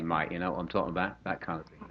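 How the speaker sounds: low, quick Cockney aside; Cockney accent. Shown as a demonstration. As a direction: mutedly toss it off in Cockney. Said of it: "a la Cockney".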